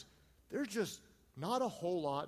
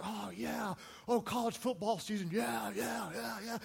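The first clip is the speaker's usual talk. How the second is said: imitated sighing agreement, trailing into repeated yeahs; mock relief; 3 semitones higher than usual. Family grasping at an easy topic, mock relieved. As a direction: act out a relieved group chiming in. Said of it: "everybody kinda breathes a sigh of relief".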